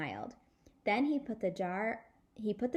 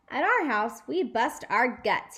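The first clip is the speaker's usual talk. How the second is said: put-on boyish boast; 3 semitones higher, 7 dB louder than usual. Boys joking at table.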